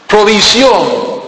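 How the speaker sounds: Provisión drawn out slowly and stressed; slow emphatic word. Real, not acted. Preacher hammering the key word to the congregation, slowly. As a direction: stretch Provisión for weight.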